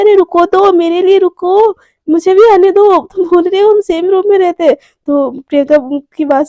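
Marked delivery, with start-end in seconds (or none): 0.0-4.7 louder, higher plea to wait; calling after someone. Retold or imitated. Priyanka asking Garima to wait for her, calling out. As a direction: call out as if to someone hurrying off.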